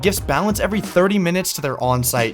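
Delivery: loud, high-energy sponsor read. Acted. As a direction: hype it like a commercial.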